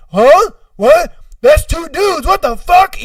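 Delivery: high, loud startled disbelief; acted shock. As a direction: escalate from a yelped question to outrage. Buyer shocked by the wrong tape.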